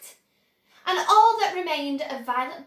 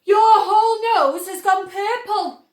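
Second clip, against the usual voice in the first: higher and much louder alarmed shout; 7 semitones higher, 11 dB louder than usual. A narrator's reading. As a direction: yell it in alarm at the daughter. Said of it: "shouted Mister Beauregard".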